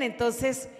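ordinary talk, nothing marked

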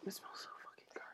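hushed whisper. Real, not acted. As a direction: whisper it like a secret. Whispering about food smell.

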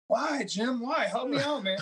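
high whiny mock plea to a friend; desperate high plea. Pleading in retold dream.

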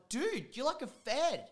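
high, drawn-out teasing amazement; re-enacted high, slow ribbing; amazed exclamation. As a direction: stretch DUDE high in mock awe. Acts out telling his brother.

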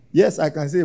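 ordinary delivery

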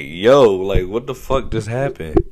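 loud shocked "Yo"; shocked exclaim. Retold or imitated. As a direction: shout your disbelief at the screen. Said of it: "And I'm like".

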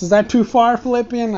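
a mock-innocent tease; mock teasing. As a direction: needle him playfully. Teasing the creature.

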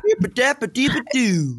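put-on high-pitched nonsense parody of a Southern accent; Jasmine's mock accent. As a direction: mimic a thick cartoonish Southern accent in gibberish. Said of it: "as soon as she's in the diary room".

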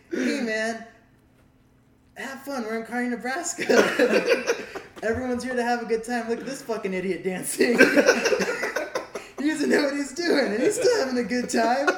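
put-on high, loud, laughing drunk teasing; laughing drunk mockery. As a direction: cackle through the words like a drunk idiot. Retelling own drunk mockery laughing.

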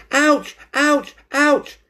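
high, loud yelps; screaming in pain. A narrator's reading. Cat tugs his own whiskers, screaming. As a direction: yelp sharply three times. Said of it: "he screamed".

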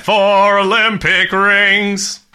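slow, loud grand proclamation; slow grand announcer. Pretend. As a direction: announce it like a ceremony. Mock grand announcement of rating.

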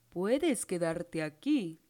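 low, slow prince voice; kind prince. A narrator's reading. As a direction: offer it gently and slowly. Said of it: "dijo el príncipe".

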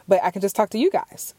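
normal talking voice

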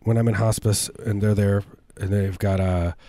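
ordinary talk

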